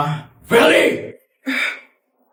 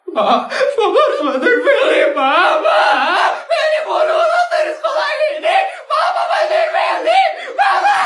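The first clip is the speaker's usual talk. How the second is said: very high wailing; sobbing plea; 14 semitones higher than usual. Acted. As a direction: plead with him crying. Daughter begs father for help.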